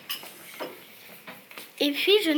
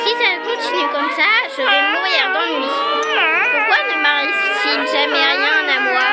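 exasperated; 5 semitones higher, 5 dB louder than usual. Acted. Bored child's thought, exasperated.

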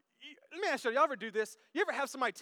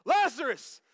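loud, high shouted command; Jesus calling out; 7 semitones higher, 9 dB louder, slower than usual. Acted. Preacher re-enacts Jesus summoning Lazarus, shouting. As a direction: bellow the summons. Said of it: "Jesus walks up".

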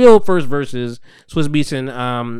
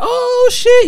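excited crowd; 4 dB louder than usual. Retold or imitated. Crowd reaction imitated.

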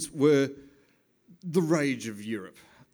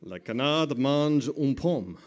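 slow, careful French; French phrase; 4 dB louder, slower than usual. Shown as a demonstration. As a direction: say it proudly in French. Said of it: "I can say".

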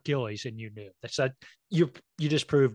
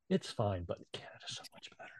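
hushed, secretive whisper; conspiratorial whisper; 4 semitones lower, 11 dB quieter than usual. Acted. Kid whispering to a buddy during the pledge of allegiance, whispered. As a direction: whisper it on the sly to a friend. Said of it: "you're whispering to your buddy".

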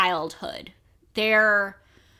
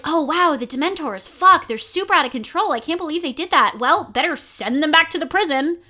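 higher-pitched, louder mock alarm; mock dismayed official; 6 semitones higher, 4 dB louder than usual. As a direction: play up the fake dismay. Mocking officials' reaction to rogue Dementors.

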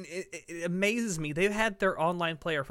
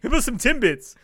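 much higher, louder put-on Canadian accent; mock Canadian accent; 8 semitones higher, 7 dB louder than usual. Cohost keeping the Canada joke going, in a mock Canadian accent. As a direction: tease like a Tim Hortons-loving Canadian. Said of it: "that's the exact accent we use".

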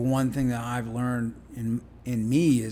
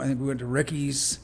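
usual speaking voice

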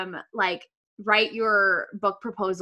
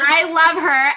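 gushing love; 7 semitones higher, 8 dB louder, slower than usual. Real, not acted. Agreeing about Dana warmly.

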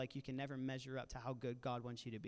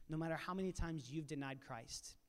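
ordinary talk, nothing marked

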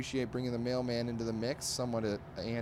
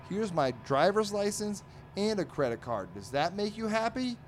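raised pitch, testy cartoon voice; mock exasperation; 10 semitones higher than usual. Dagwood showing the mailman two cards, mock testy. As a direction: act out peeved compliance, pitched up.

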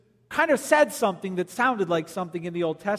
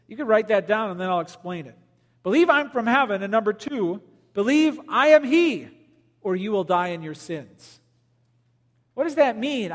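usual speaking voice

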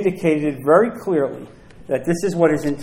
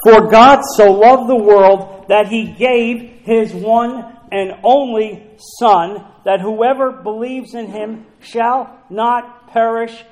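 slow, raised-pitch pulpit reading; measured Bible reading with weighty pauses; scripture reading; 5 semitones higher, 6 dB louder, slower than usual. Acted. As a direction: proclaim the verse deliberately, starting loud. Reads scripture aloud.

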